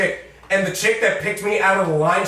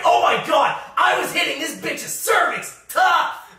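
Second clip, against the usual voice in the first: energetic guy; 12 semitones higher than usual. Retold or imitated. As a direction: yell it like an overexcited guy. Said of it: "one of the voices I did was this really energetic guy".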